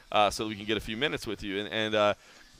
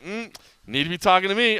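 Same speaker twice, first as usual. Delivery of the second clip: swagger; 8 semitones higher, 5 dB louder than usual. Retold or imitated. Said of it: "swagger to just be like".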